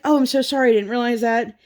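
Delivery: high-pitched retold apology; apologetic employee. Acting apologetic drive-through worker.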